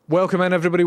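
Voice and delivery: high, loud showman greeting; big welcome. Real, not acted. Streamer opening the show with a big loud welcome. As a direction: boom it out to the viewers.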